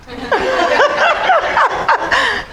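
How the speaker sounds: big high-pitched laugh. Real, not acted. Laughing at her own stage fright, a high burst of laughter.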